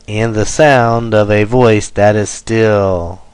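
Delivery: loud beats on "sound", "voice" and "still"; anapestic stress. Shown as a demonstration. Teacher reading anapestic trimeter example, stressing every third syllable. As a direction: swing through light words onto "sound", "voice", "still". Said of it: "unstressed, unstressed, stressed".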